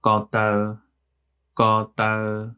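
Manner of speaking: careful Hmong demo; Hmong word. Shown as a demonstration. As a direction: say "ko tau" slowly and clearly, in Hmong tones. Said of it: "the word for foot in Hmong is".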